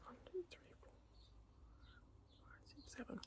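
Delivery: counting under her breath; whispered counting. Real, not acted. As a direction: whisper the numbers to yourself.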